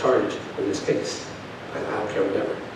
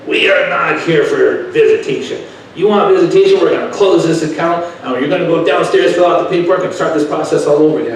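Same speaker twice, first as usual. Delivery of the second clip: higher, louder mock yell of the attorney; retold yelling; 6 semitones higher, 9 dB louder than usual. Attorney yelling at the father over visitation, retold loudly. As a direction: bark it back like the angry attorney. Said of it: "He starts yelling at me".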